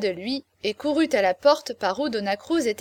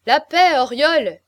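raised, loud call for silence; 4 semitones higher, 9 dB louder, slower than usual. A narrator's reading. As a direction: silence him with a louder, higher call. Company tells Oriol to hush.

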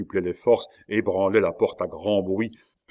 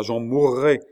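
raised, higher cry of distress; pleading cry; 4 semitones higher, 5 dB louder than usual. A narrator's reading. Rosa pleading with Cornelius, crying out. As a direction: cry it out, desperate. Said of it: "criait Rosa".

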